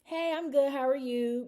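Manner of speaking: bright high fake-fine greeting; chirpy autopilot reply. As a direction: sing out the automatic I'm good. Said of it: "you know how we are".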